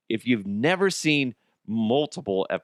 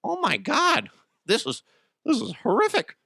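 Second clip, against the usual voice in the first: high, shocked gasp; mock horrified gasp; 13 semitones higher than usual. Appalled audience members reacting to the scene, high shocked gasp. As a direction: gasp like a prudish moviegoer. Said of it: "And people are going".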